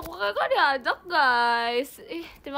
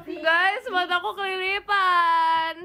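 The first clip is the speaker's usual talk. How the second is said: loud, high drawn-out wail; whining complaint; 4 semitones higher, 5 dB louder, much slower than usual. Real, not acted. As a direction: whine loudly to the audience, stretching the last word. Complains of something in her eye.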